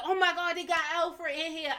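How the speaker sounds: imitating her own surprised reaction to the preview; excited disbelief. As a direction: retell the shock of seeing the trailer. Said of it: "I was like".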